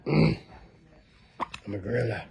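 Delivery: low slow grunty gorilla; hammed-up gorilla, low and slow. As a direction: lumber through it like a big gorilla. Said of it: "like, grunting, like".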